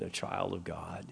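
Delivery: hushed, breathy whisper on the last words; whispered aside. Real, not acted. Preacher whispering to the congregation about being God's child. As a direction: drop to a whisper to draw them in.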